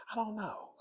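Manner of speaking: high, very soft reply; soft giggly hush; whispering. Pretend. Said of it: "I don't know why I'm whispering".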